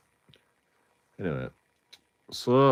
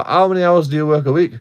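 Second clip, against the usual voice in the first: official asking; 6 semitones higher, 7 dB louder, faster than usual. Retold or imitated. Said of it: "They go".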